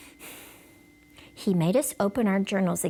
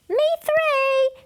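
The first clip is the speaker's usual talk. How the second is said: Shirley's voice; 5 dB louder than usual. Acted. Shirley's voice, child.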